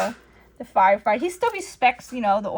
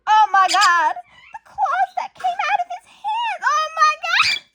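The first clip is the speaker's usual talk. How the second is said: high, loud panicked outburst; much higher, louder hammed-up panic; mock panic; 5 dB louder than usual. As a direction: freak out at the news. [name] panics at news.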